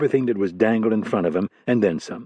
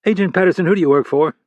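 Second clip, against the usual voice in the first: higher, louder, demanding boss on the phone; a senior director grilling a junior agent; stern FBI boss; 7 semitones higher, 4 dB louder, faster than usual. Acted.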